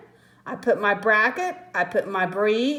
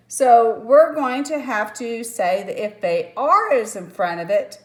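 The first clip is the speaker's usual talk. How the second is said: ordinary delivery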